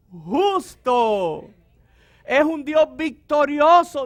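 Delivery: fiery preacher shouting to the congregation; full-force preaching. Acted. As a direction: preach it out to the church.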